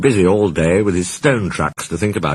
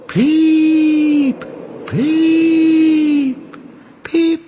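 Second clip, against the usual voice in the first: shrieked; much slower than usual. A narrator's reading. Percy haunting the shed, high ghostly shrieks. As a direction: wail each peep way up high. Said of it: "he shrieked".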